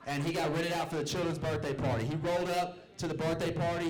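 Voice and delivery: ordinary talk, nothing marked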